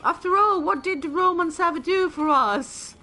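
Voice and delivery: high, loud, indignant Monty Python rebel voice; hamming up the Judean rebels' grumbling question; mock Life of Brian crowd. Gamer quoting Life of Brian to friends, in a high indignant mock voice. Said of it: "when they say".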